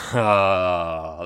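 loud, drawn-out laughing "ah"; big satisfied laughing exhale; laughing sigh. Real, not acted. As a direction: let out a long amused "ah". Laughing at own accented reading.

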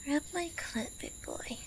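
very soft, breath-only whisper; intimate whisper. Acted. Woman giving her partner an instruction, whispered. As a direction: drop to a hushed whisper. Intimate turn in acted scene.

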